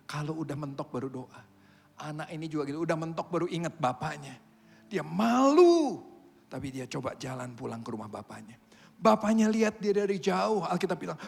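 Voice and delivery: ordinary delivery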